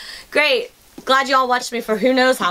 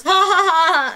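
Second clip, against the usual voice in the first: imitated laugh; 6 semitones higher, slower than usual. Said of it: "And he goes".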